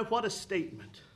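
usual speaking voice

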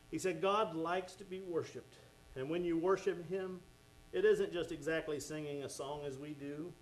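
usual speaking voice

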